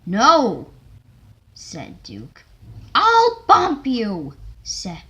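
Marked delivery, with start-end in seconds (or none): 0.1-0.7 loud refusal. Acted. Duke refusing loudly. 2.9-4.4 loud threat. Acted. Stewart threatening a bump.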